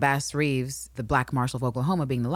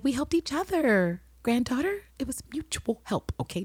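high breathy Will voice; 9 semitones higher, 3 dB quieter than usual. Retold or imitated.

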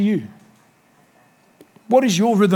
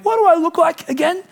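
much higher, puzzled mock question; mock confused; 11 semitones higher than usual. Pastor mocking forgetful Christians, high and puzzled. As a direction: ask it high like a confused fool.

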